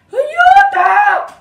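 high, sharp yell for help; cry for help. Acted. Lars calls for help after falling into wire, panicked shout.

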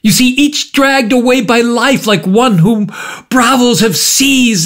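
high, impassioned outpouring; cried out. A narrator's reading. Markheim defending his life to the visitor, crying out. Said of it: "cried Markham".

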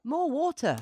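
high, loud cry for water; eager call. A narrator's reading. Beryl the bucket urging everyone on, eagerly. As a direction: call out keen to help. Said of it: "sloshed Beryl".

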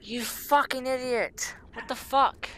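high, slow furious yelling; real rage at a clumsy character; angry shout. Real, not acted. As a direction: drag out the insult in rage.

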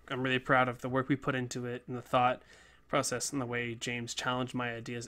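normal talking voice

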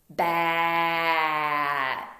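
Bat stretched, the middle vowel held at the top; roller coaster word. Shown as a demonstration. Teacher stretching Bat so kids hear the middle sound. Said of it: "So we're gonna roller coaster".

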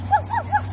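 loud high puppy barks; dog barking. Pretend. Child singing about her dog, loud high puppy barks. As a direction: bark out loud like a little dog.

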